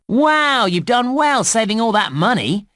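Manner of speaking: high-pitched, delighted wow; excited game-host congratulations; impressed congratulations. Acted. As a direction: gush praise, starting high and easing down. Congratulating player on saving money.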